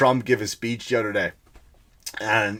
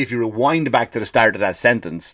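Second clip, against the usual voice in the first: usual speaking voice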